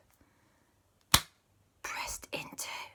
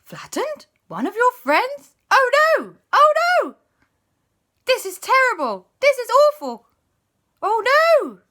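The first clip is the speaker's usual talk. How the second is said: high, loud alarmed cries; high panic; 11 dB louder than usual. Acted. Friends fearing someone flattened, high loud panic.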